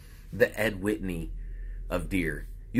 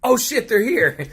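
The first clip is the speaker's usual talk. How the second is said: much higher, louder, slowed panicked cry; mock panic; 11 semitones higher, 6 dB louder, slower than usual. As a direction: play a fighter bolting in terror. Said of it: "he's like".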